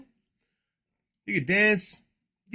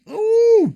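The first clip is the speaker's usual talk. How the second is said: high, loud drawn-out ooh; excited ooh; 11 dB louder than usual. Real, not acted. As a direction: whoop an ooh at a great find. Spots a rookie card.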